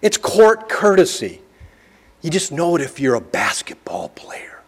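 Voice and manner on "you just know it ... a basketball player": a quick unvoiced whisper; confiding whisper to the congregation; whispered aside. Real, not acted. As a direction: let it slip as a secret aside.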